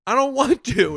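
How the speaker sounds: high, loud mock tantrum; whining protest. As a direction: protest like a kid refusing. Comic whining back at manager.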